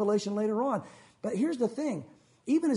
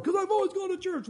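smug churchgoer; 9 semitones higher than usual. Retold or imitated. Mimics complacent churchgoer.

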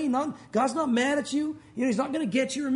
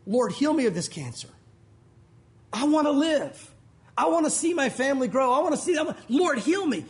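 acted begging prayer, rising higher and louder; desperate plea; 3 semitones higher, 4 dB louder than usual. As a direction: plead for your life, building in force. Acts a cancer patient's desperate prayer.

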